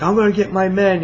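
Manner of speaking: high-pitched imitation of panic; mock freaking out. Panicking president to the cowboy, mock freak-out. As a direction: raise the pitch as a retold panicky threat. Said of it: "the president starts freaking out".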